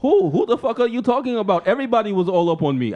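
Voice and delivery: high, mock-indignant denial; indignant husband. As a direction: protest loudly at first, hamming up innocence. Acted quarrel as defensive Conor.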